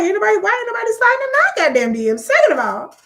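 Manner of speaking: high, loud comic protest; worked-up, high-pitched griping; mock outrage. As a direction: complain in exaggerated disbelief. Comic complaint about own DMs.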